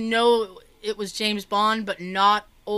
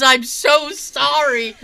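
put-on loud, high, drawn-out childlike apology; excited kid; 4 semitones higher, 8 dB louder, much slower than usual. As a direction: gush it like an overawed kid. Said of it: "And he's like".

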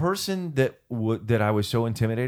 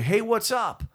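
radio DJ voice; 8 semitones higher than usual. Retold or imitated. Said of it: "go out in front of huge crowds to say".

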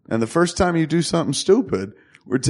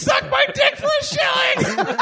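very high parrot squawk; shrieking parrot echo; awful parrot. Acted. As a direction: screech it back like a parrot. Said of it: "he's like an awful parrot".

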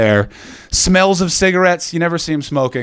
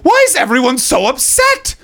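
high-pitched, naive tourist questioning; drawn-out, high-voiced innocent bewilderment; clueless tourist; 9 semitones higher, slower than usual. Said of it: "you're like".

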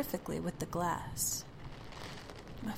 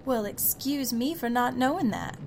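high, loud sarcastic huff; sassy; 6 semitones higher, 6 dB louder than usual. Acted. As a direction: snap back with crossed arms. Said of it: "I say, crossing my arms".

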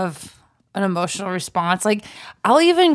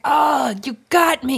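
higher, hammed-up defeated outcry; mock exasperation; 4 semitones higher, slower than usual. Viewer caught crying at a bad movie, mock-exasperated. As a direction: concede defeat with comic outrage. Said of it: "And here I am crying".

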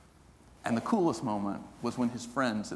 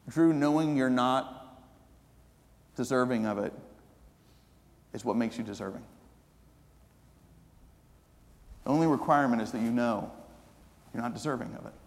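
gentle reassurance; 2 semitones lower than usual. Retold or imitated. Wife gently answers hesitant Drew.

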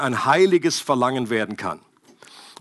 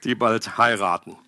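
ordinary talk, nothing marked

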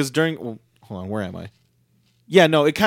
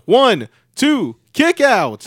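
excited count; 7 semitones higher, 4 dB louder, much slower than usual. Acted. Play-by-play pin count.

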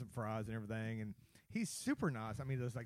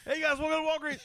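very high, loud put-on cashier voice; mocking cashier greeting; 14 semitones higher, 10 dB louder, faster than usual. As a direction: squeal it like a parody clerk. Friend mimics cashier greeting.

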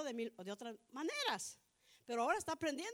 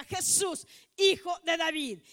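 loud, raised cry for attention; insistent shouting; 3 semitones higher, 10 dB louder, slower than usual. Acted. Preacher voices Bartimeo calling louder despite hushing, insistent shout. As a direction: shout it out over the crowd. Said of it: "más le decía".

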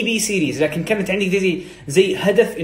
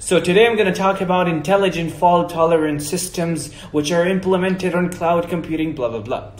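smooth, connected American English delivery; fluent American accent; much slower than usual. Shown as a demonstration. Second conference speaker opening a talk, fluent American accent. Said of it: "واحد يقول".

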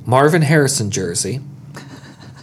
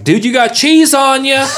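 much higher and louder, a passerby calling out; high, loud imitation of a gawking stranger; mocking stranger; 12 semitones higher, 4 dB louder than usual. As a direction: pitch it way up like a random fan pointing it out. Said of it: "people are like".

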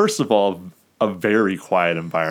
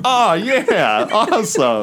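high, loud "Oh, yeah" dissolving into a laugh on "awesome"; laughing delight; 13 semitones higher, 4 dB louder, slower than usual. Retold or imitated. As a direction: cheer back at the heckler, amused. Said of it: "And I'd be like".

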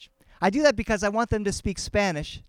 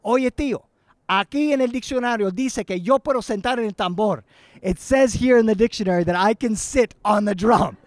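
put-on higher, louder voice of an insistent child; acted small son; 4 semitones higher, 6 dB louder than usual. Son citing the dictionary to his uncle, acted child voice. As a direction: announce it like a triumphant little boy, lightly acted. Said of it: "and he goes".

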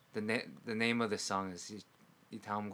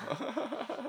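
high, thin put-on voice; faster than usual. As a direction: ask it in a squeaky high voice. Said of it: "And it's like".

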